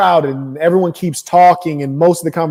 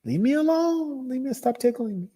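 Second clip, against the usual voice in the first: being tickled; 9 semitones higher, 6 dB quieter than usual. Retold or imitated. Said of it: "Bruh, that was you".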